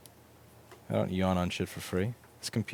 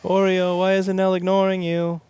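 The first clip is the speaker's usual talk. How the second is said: high cat-talk voice; 11 semitones higher, 7 dB louder than usual. Real, not acted. Owner calling cat Oreo in high baby talk. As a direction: coo at the kitty.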